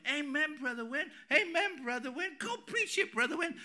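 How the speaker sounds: high-pitched mock cheering of the preacher; high, eager amen-corner impression; imitating an excited congregant. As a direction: mimic a keen young woman calling out from the pews. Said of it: "she's standing up going".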